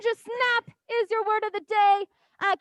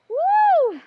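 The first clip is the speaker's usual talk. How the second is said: high, loud whoop; excited cheer; 8 semitones higher, 7 dB louder than usual. Real, not acted. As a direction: whoop to fire up the class. Cheering on the class.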